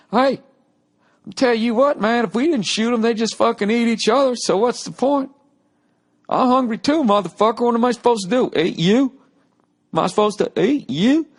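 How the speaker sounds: a hollering Southern hunter defending hunting. Acted. Southern hunter arguing for hunting, loud and high. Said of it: "am I actually presenting the Southern argument".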